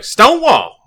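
loud, high imitated dice-roll shout; rolling battle cry. Friendly opponent rolling dice at the table, loud high imitated shout. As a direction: holler it like a catchphrase over the dice. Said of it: "he's like".

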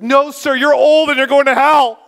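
high, loud pleading wail; crying out. Retold or imitated. Evangelist kneeling and pleading with a hardened stranger, loud and high. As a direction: beg him from your knees at full voice. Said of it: "He starts crying out".

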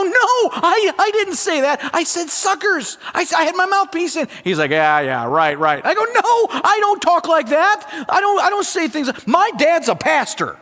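high pleading protest to the referee; frantic protest. Retold or imitated. Boy protesting to the referee, high frantic pleading. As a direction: beg the ref, voice way up.

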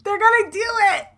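high, loud gleeful squeal; real thrilled exclamation; gleeful excitement. Real, not acted. Teasing glee about a couple.

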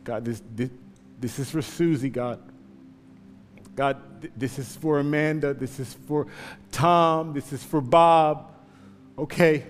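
praying under his breath while running. Acted. Runner's quiet prayer mid race.

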